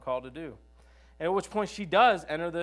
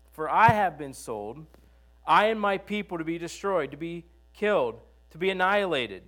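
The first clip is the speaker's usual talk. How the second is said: louder, urgent plea; pleading queen; 3 semitones higher, 7 dB louder than usual. Acted. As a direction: beg for your people's lives. Esther's plea read aloud.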